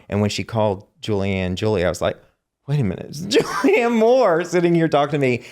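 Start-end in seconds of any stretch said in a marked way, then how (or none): 2.7-5.4 high, excited retold disbelief with a laugh; starstruck amazement. Newcomer realizes a film star is talking to him, starstruck. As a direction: act out a thrilled double take.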